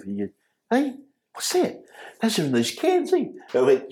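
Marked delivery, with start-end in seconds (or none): 0.7-3.2 curious high voice. Retold or imitated. Panther asking street kids about food, in a high curious voice.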